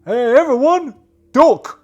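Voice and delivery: high, loud yell to duck; warning shout. Acted. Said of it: "X O's gonna say".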